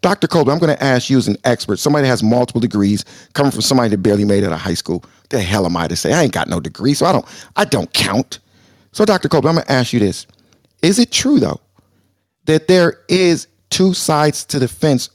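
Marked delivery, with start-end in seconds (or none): 0.0-1.8 dial it down to sound humble; calmed down. Shown as a demonstration. Host restarts his question to the doctor, calm and low. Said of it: "So let me calm down". 1.8-10.3 much lower, softer, self-deprecating; calmed down. Real, not acted. Host humbly sets up his question to the doctor, low and soft. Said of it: "So let me calm down".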